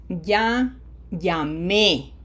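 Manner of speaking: "ya, llamé" slowly, with the emphasis on the final é; stress on final É. Shown as a demonstration. Teacher showing what the accent mark does, final-syllable stress on "llamé". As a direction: lean on the last syllable of "llamé". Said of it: "So I put emphasis there".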